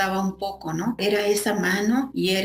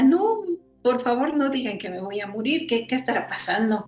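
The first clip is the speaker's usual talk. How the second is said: retold frightened plea; 5 semitones higher than usual. Frightened patient begging in hospital.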